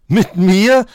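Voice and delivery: outraged disbelief, topping the first Mit mir; mocking astonishment. A narrator's reading. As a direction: Mit mir shrieked up in scornful surprise. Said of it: "spottete der Hase erstaunt".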